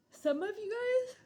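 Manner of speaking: high, loud words stretched by a yawn; a real yawn swallowing the words; mid-yawn. Real, not acted. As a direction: talk straight through a yawn. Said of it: "I don't know why I'm yawning".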